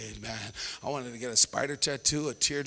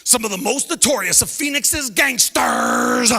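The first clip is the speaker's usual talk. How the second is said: louder mock news voice, drawn-out ending; exaggerating newscaster; 3 semitones higher, 8 dB louder, slower than usual. Newscaster hyping local criminals to viewers, exaggerated announcer. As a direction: sensational anchor stretching the last word. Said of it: "you listen to the news".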